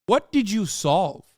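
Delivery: usual speaking voice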